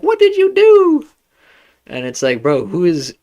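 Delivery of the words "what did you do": very high, loud mock shriek; imitated lady yelling. Random lady yelling at Kratos about his son, mock yell. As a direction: yell it in an exaggerated high woman's voice. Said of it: "random lady yelling".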